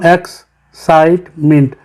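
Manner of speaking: Excitement slowly in three separate beats; teacher breaking excitement into three parts; split into syllables. Shown as a demonstration. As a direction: pause after x and site in excitement. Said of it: "इसे वैसे हम divide करते हैं".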